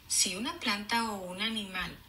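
clear, even read-aloud; storybook reading voice; reading to children. Acted. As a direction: read the page to the class. Teacher reads book aloud.

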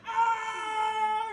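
high shouted scream; a very high panicked shout; pilot's scream. Acted. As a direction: yell as the blast hits you. Pilot falling backwards.

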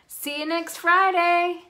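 high and loud farewell call; cheerful loud goodbye. A narrator's reading. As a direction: call it out across the diner. Waitress calling goodbye.

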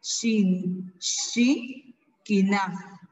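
"shin, shi, kina" in separated syllables with a hushing sh; syllable-by-syllable pronunciation. Shown as a demonstration. Teacher modelling the word for ear, "shin, shi, kina" split into syllables. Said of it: "oreja se pronuncia".

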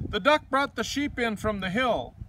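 ordinary delivery, nothing marked

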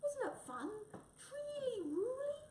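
very high, squeaky wheedling; pleading little animal voice; coaxing plea. Acted. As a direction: coax a sulking friend in a tiny high voice. Friends coax cat to stay.

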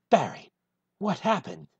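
ordinary delivery

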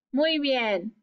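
ordinary talk, nothing marked

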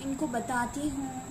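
ordinary delivery

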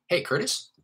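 high, rising question on "Curtis"; upward inflection. Shown as a demonstration. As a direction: lift "Curtis" as if unsure you dialed right. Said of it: "with an upward inflection".

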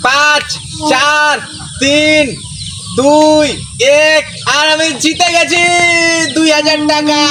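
loud countdown into a winning shout; shrieking jackpot winner. Acted.

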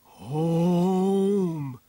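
high, loud, long-held creature call; drawn-out telepathic wail; creature's thought-voice. A narrator's reading. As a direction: hold the word long and high, like a beast's thought. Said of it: "came the thought from the neurotransducer".

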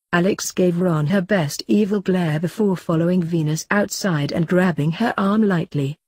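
ordinary delivery, nothing marked

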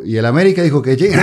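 words spilling out through a laugh. Real, not acted. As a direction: crack the joke while laughing. Joking while laughing.

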